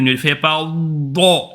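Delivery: overdone "do", slow and heavy; mock the overdone "do"; exaggerated do. Shown as a demonstration. Teacher warning against exaggerating the dod, slow. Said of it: "tu exagères sur le dod".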